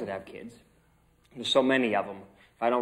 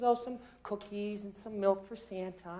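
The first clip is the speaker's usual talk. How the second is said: little niece answering Santa; 8 semitones higher, 9 dB quieter, slower than usual. Retold or imitated. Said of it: "She says".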